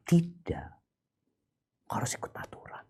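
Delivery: very soft, fast whisper; whispered answer. Acted. Preacher answering his own question in a whisper. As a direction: hush it quickly.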